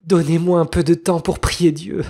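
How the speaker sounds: high voice begging for time; tearful pleading. Acted. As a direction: plead like a doomed wife. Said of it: "les yeux baignés de larmes".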